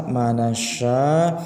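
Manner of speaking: ordinary talk, nothing marked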